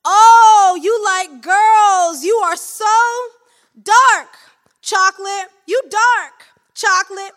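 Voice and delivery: loud, high, leering catcaller voice; hollering pickup lines at a passing woman; street catcaller. Acted. A loud, high catcaller shouting at a woman on the street.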